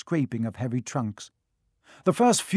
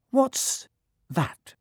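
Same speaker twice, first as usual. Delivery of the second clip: surprised twin; 10 semitones higher than usual. A narrator's reading. Twin spots the lightning scar.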